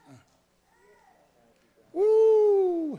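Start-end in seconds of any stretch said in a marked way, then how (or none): 1.9-3.0 very high, loud, drawn-out "woo"; praise whoop. Real, not acted. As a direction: whoop in church exultation. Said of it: "feel like a shout coming on".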